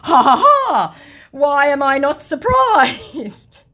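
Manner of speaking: high, loud mocking laugh, then a slow sneer; gloating scammer voice. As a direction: crow over him like a smug troll. Reading out the scammer's reply.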